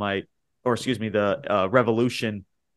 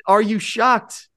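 high, slow, hammed-up disbelief; mock shock; 12 semitones higher, 7 dB louder, slower than usual. Host teasing his listeners, high, slow mock shock. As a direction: drag it out like a fake gasp.

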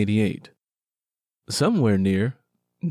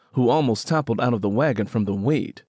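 ordinary delivery, nothing marked

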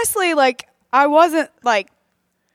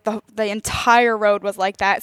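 ordinary talk

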